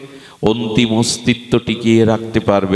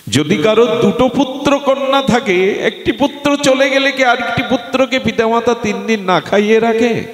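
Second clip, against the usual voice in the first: very high, sustained declamatory question; ringing preacher's challenge; impassioned preaching; 13 semitones higher than usual. Acted. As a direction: hold the pitch high and drive the question home. Preacher repeats question at full force.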